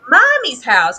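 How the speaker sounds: hammed-up childish whine. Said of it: "It's just like".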